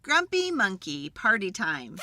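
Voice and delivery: ordinary delivery, nothing marked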